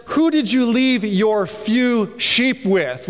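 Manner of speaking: slow, sneering put-down; a big brother sneering at young David; mocking older brother. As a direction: belittle your little brother slowly. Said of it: "Notice that he says".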